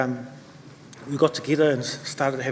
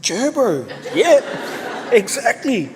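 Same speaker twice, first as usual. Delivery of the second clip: higher, louder, mock-easy "sure, bro"; playful agreement; 8 semitones higher, 5 dB louder, much slower than usual. Teacher humours the proud boy, higher and louder. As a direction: humour the kid with a grin. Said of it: "I was going".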